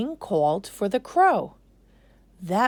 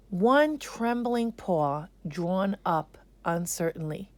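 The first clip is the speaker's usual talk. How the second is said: ordinary delivery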